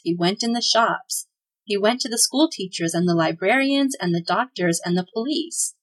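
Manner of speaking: ordinary delivery, nothing marked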